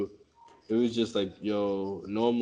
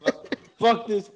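laughing curse; 9 semitones higher, 6 dB louder, slower than usual. Real, not acted. Laughing exasperation.

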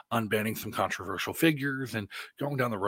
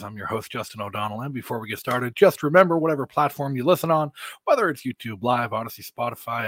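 ordinary delivery

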